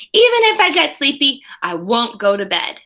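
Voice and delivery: ordinary delivery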